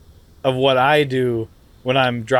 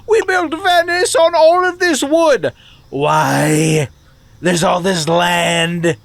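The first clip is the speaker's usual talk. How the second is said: high, strange put-on voice; squeaky mock caller rant; put-on caller character; 4 semitones higher than usual. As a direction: play an oddball caller, pitched way up.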